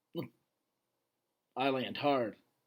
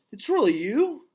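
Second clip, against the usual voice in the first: amazed; 9 semitones higher, 6 dB louder than usual. Acted. Gorilla amazed seeing old friend.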